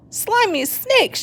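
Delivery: high, slow alarmed cry; cried out. A narrator's reading. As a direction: exclaim in a hurry. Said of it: "she cried".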